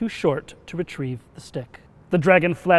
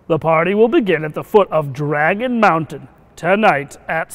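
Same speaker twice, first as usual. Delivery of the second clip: royal proclamation; 3 semitones higher, 6 dB louder than usual. Acted. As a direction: proclaim it loudly like a haughty royal invitation. Reads royal invitation aloud in story.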